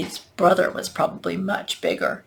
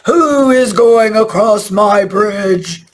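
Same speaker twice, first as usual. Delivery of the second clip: loud, drawn-out troll roar; big slow growly challenge; growling troll; 2 semitones higher, 8 dB louder, slower than usual. A narrator's reading. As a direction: bellow it slowly, like a troll guarding his bridge.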